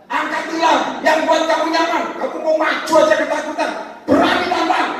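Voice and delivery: loud, high-pitched exhortation; full-force preaching. Real, not acted. Preacher urging fearful people to dare, forceful exhortation. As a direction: challenge the congregation at full volume.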